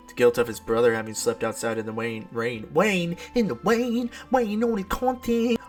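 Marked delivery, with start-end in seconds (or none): 2.7-5.6 high, drawn-out silly voice; loud, high mock baby talk; babyish mock slip. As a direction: play up the slip, high and goofy. Mocks own slip of rain.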